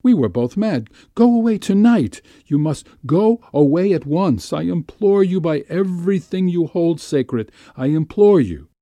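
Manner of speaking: high, loud, urgent begging; frantic high-pitched entreaty; panicked pleading. A narrator's reading. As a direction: beg desperately, voice leaping up. Terrified woman begs lover to leave.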